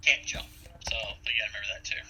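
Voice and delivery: ordinary talk, nothing marked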